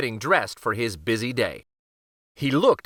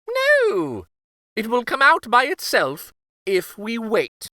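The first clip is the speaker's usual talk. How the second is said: very high no, then high protest; stubborn king; 10 semitones higher, 4 dB louder than usual. Acted. King to Gloria and queen, high stubborn protest. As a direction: squeak no and insist. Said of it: "said King Kenric".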